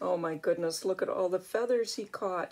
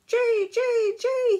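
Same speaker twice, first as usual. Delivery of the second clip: high, loud bird cry; blue jay call; 9 dB louder, slower than usual. Acted. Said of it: "he calls from the pine tree".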